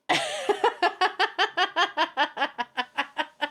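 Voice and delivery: high, rapid staccato cackle; long cackle. Real, not acted. Streamer amused by her own taunting, high staccato cackle. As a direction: let out a long machine-gun laugh. Laughs at smug cat joke.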